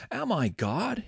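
a touch of narrated outrage; mildly furious. A narrator's reading. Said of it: "Jacob became furious with Rachel".

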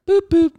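loud, high playful beeps; high boop boop. Acted. Host kicking off trivia, loud high beeps.

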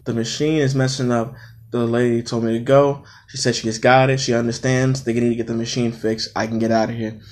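low, soft, restrained recital; subdued explanation. Retold or imitated. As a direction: hold the anger down and explain quietly. Retelling subdued explanation to coworkers.

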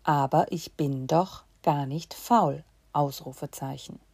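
ordinary talk

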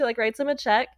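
ordinary delivery

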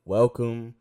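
loud hyped welcome; loud opening welcome to listeners; big welcome. Real, not acted. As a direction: throw the welcome out to the room. Opening greeting of podcast.

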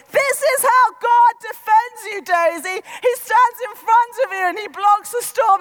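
loud, high yell over a gale; shouting. Retold or imitated. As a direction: shout to a child through the storm. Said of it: "shouting at my three-year-old".

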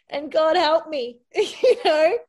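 high, loud laughing plea; real amused exasperation. Real, not acted. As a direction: laugh and beg for help.

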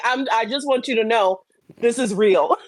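ordinary delivery

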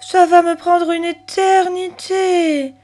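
put-on high, slow, drawn-out child's whine; sighing complaint. A narrator's reading. Chloé facing endless saving, exasperated sigh. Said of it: "soupira Chloé".

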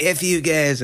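high-pitched imitation of a cartoon character; Cartman. Retelling a bitter drunk's parting shot, imitated in a high voice. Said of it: "we just see Cartman".

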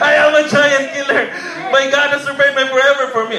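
loud, high triumphant boast; proud boast. Retold or imitated. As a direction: puff up and proclaim it like a champion. Boasting giant-killer claim loudly.

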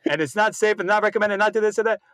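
high rattling auctioneer gabble; rapid-fire disclaimer. Retold or imitated. Host mocking drug commercial announcer, rapid-fire disclaimer patter. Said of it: "that guy who speaks, like, a thousand words a minute".

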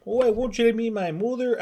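high Wisconsin accent, sliding toward Canadian; put-on Upper Midwest accent. Shown as a demonstration. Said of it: "the Wisconsin".

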